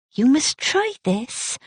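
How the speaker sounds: a gentle West Country Gloucester hostess offering buns; mild Gloucester accent, kindly. Acted. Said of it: "she said in a gentle Gloucester accent".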